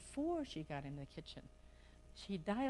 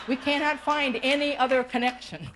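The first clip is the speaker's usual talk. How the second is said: louder, pressing the point home; emphatic; 4 semitones higher, 13 dB louder than usual. Real, not acted. Speaker to audience, loud and emphatic. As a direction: hammer the point to the room.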